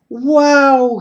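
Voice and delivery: high, loud gleeful whoop; excited shout. Acted. Toby finally gliding on the ice, excited shout. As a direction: shout it with sheer delight. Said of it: "he shouted".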